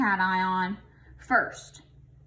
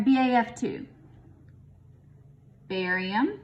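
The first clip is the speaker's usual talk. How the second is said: ordinary talk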